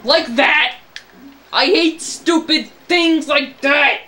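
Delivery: loud, high, drawn-out rant; exasperated yell. Real, not acted. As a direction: shout it in frustration at the game. Ranting at the game's enemies.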